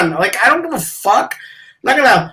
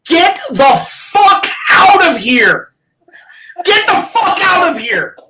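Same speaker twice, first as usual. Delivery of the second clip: shouted, higher, slowed-down curses; angry rant; 5 semitones higher, slower than usual. Real, not acted. Mocking podcasters, he yells in angry rant. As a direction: blow up at the fake congratulators. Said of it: "And that is your rant".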